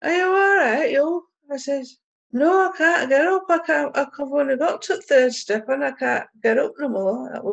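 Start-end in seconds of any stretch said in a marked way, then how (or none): 0.0-1.3 raised, high-pitched call to someone; mildly shouted, high retold question; shouted check-in. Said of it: "So she shouts to me". 2.3-7.4 high-pitched call for help, easing off; raised retold shout, fading toward usual; calling back.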